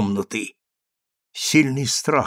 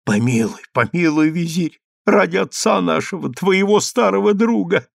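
plea climbing to a high wail; tearful pleading; 8 semitones higher than usual. A narrator's reading. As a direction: beg through tears for your sister, voice rising. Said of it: "говорила, плача, Скулика".

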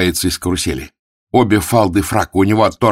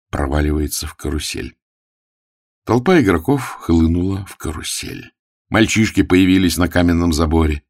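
usual speaking voice

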